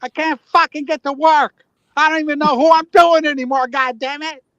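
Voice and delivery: high-pitched hammed-up rant; mad. Caller who cannot get to work, acting mad. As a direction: wail in mock frustration. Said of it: "I never heard him get mad".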